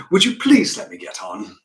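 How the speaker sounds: loud, brisk brush-off without anger; busy father's emphatic brush-off; dismissive. Acted. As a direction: dismiss it without really hearing it. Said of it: "he just dismisses it".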